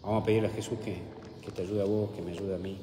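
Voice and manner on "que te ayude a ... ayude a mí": ordinary talk, nothing marked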